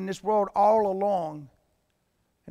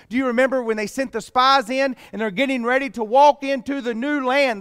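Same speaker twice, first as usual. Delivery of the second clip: normal talking voice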